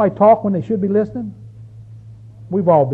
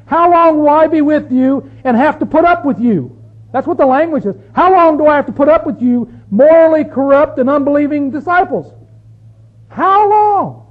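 loud, higher exasperated preaching; exasperated rebuke; 7 semitones higher, 7 dB louder than usual. Acted. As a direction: rise to a loud cry of how long. Jesus rebuking disciples, preached.

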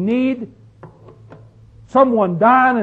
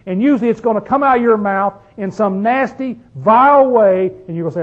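normal talking voice

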